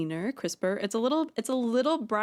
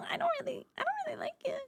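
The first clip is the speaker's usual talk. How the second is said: much higher, fast squeaky cartoon voice, softer; silly squeaky voice; 7 dB quieter, faster than usual. Streamer voicing her head's reaction to mouth noises, squeaky cartoon voice. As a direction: squeak it out like a tiny cartoon critter. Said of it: "It makes my head go like".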